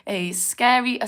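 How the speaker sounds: ordinary talk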